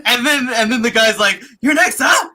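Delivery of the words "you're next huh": loud, high-pitched acted taunt; mock killer's threat. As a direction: call it out like a manic movie killer. Acting out chainsaw killer's threat.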